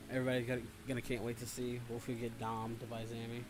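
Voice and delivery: ordinary delivery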